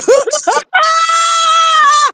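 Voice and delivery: high wailing laugh, near a shout; loud crying laugh. Real, not acted. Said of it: "that wasn't even the funny part".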